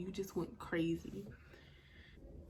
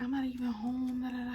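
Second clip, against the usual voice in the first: higher, louder retold comeback; exasperated retort; 5 semitones higher, 6 dB louder than usual. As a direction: rattle it off, fed up. Retold argument, brushing off his threats.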